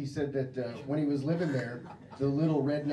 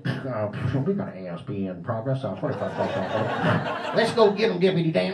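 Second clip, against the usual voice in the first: cop on radio; 7 dB louder than usual. Pretend. Cop voice taking a call.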